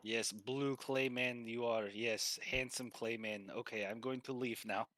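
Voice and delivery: put-on unhurried Russian-accented praise; approving Russian masseur. Masseur compliments clay man before leaving, Russian accent. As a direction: admire them in a Russian accent. Game master plays the masseur.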